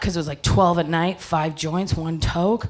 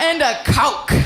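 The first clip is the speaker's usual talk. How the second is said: high, insistent add-on to an order; exaggerated customer voice; stoned ordering; 6 semitones higher, 3 dB louder than usual. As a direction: tack it on, pitched up and oblivious.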